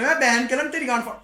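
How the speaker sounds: loud, high mock rant; cursing rant. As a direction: re-enact losing it and swearing. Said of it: "मैं लगा पड़ा गाली बकने". (ऊँची आवाज़ में गाली बकने का अभिनय)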